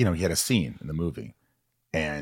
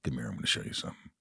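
imitating Piper, low, soft and fast; low and quiet, leaning in to show a move; low, quick wrestler voice; 7 semitones lower, 10 dB quieter, much faster than usual. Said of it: "looked at me and goes".

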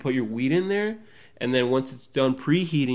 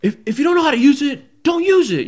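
high, loud mock lecture from staff lady; scolding lady; 13 semitones higher, 8 dB louder than usual. Staff lady lecturing a tourist who misused the machine, high and loud. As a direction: wag a finger at the clueless customer.

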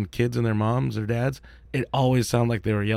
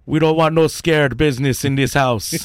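put-on slow Jamaican accent, a touch louder; Belly movie quote; 4 semitones higher, 4 dB louder, slower than usual. Quoting the movie Belly to co-hosts in a Jamaican accent, slow. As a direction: lean into a Jamaican gangster line, slow. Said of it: "you've seen Belly".

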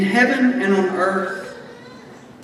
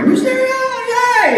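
Mysterion, yay! yelled much higher like a party shout; surprise party cheer; 13 semitones higher than usual. Retold or imitated. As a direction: cheer it like the lights just came on. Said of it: "what you would yell at a surprise birthday party".

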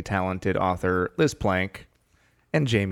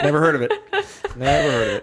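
amused, sarcastic shrug; laughing sarcasm; 5 semitones higher, 6 dB louder, much faster than usual. Acted. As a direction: laugh through a sarcastic line. Joking sarcastic echo.

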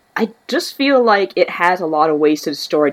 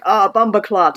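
higher-pitched Jamaican patois exclamation; imitated Jamaican cursing; 6 semitones higher, slower than usual. Co-host imitating Jamaican coworkers cursing, mock Jamaican outburst. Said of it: "Jamaicans, whenever they want to curse".

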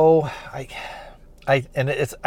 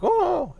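very high-pitched imitated whine; dog whimper. Recapping how the dog reacts, a high whimpering whine. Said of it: "kinda whimpers".

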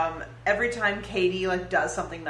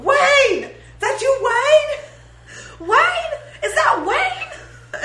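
much higher, loud laughing call; yelling; 6 dB louder, much slower than usual. Retold or imitated. Retelling bar antics to cohost, high laughing yells. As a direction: holler it across the bar. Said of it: "We just kept going".

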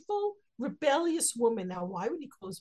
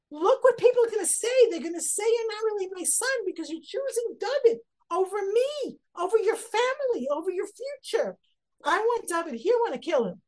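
much higher, louder furious tirade; enraged; 10 semitones higher, 6 dB louder than usual. Retold or imitated. Shaul raging at Yonatan over David, furious shout. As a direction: rage at him like a betrayed father. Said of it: "He's enraged".

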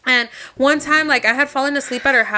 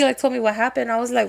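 ordinary delivery

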